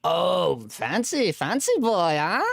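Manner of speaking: playful teasing. Real, not acted. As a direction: rib a friend about showy new gear. Host teases caller about camera.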